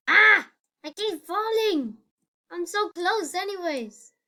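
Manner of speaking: high frustrated outburst; frustrated yell. Real, not acted. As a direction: cry out as you fall again. Falling again in game.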